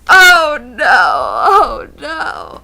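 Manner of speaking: loud wailing oh no; mock wailing. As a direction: wail it like a sobbing child. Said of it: "and I'm like".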